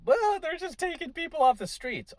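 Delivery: high mocking voice of a protester; hammed-up whiny critic; mocking liberal voice. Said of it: "You know".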